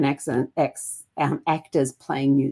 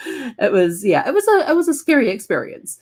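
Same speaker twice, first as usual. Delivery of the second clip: high-pitched, louder, laughing through the words; real giggle carried into the speech; amused, laughing recollection; 10 semitones higher, 4 dB louder than usual. Real, not acted. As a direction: talk through your laughter. Laughing as she recalls a scare.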